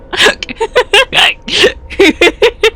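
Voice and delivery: squealing laughter far up in pitch; helpless high laughter. Real, not acted. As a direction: lose it completely, laughing high. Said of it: "I can't stop laughing".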